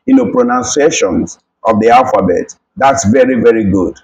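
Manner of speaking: normal talking voice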